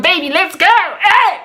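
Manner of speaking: high, playful party hype; hammed-up eager cheer; excited call-out. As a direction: call your babe out to party, dancing. Acts out dancing out to date.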